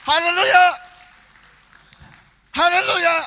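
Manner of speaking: high, loud shout of praise; loud ringing hallelujah; exultant haleluya. Real, not acted. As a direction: cry out hallelujah to the church. Preacher exclaims hallelujah.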